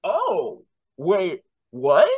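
high, startled double take; stunned surprise. Retold or imitated. The son, hearing grandfather say I love you, stunned surprise. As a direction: caught completely off guard, pitch jumping up.

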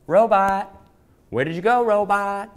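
high, loud playful call. Acted. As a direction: call out for the lost robot. Calling for robot.

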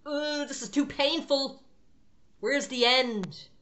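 hammed-up limping runner groaning about the pain. Preacher acting a worn-out believer who wants the race over, in a mock pained whine. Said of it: "you're like".

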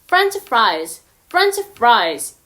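French fries said the American way; American pronunciation; American demo. Shown as a demonstration. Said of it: "미국 발음은".

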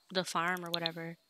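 normal talking voice

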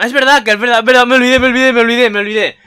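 high, loud excited outburst; sudden realization. Real, not acted. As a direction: blurt it out, suddenly remembering. Suddenly remembers forgotten scene detail. (de golpe, gritando que se le olvidó)

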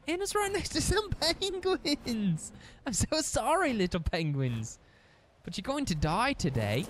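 high, sing-song fake apology; mock sorry to penguins. Player apologising to the little penguins she will kill, mock sweet. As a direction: sweetly tell the penguins their fate. Addressing penguins before killing them.

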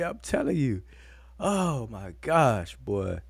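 ordinary talk

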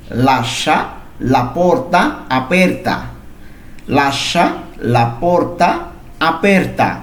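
very slow, drawn-out la porta aperta; slow model reading. Shown as a demonstration. Teacher models Italian for Bengali students, slow and clear. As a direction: spell out the sentence slowly so learners can repeat. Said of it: "এর Italian কি হবে?".

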